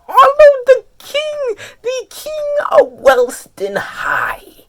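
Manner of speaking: high, slow squealed acclaim; fan adulation. Real, not acted. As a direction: hail your hero in a drawn-out squeal. Hails favourite character as king.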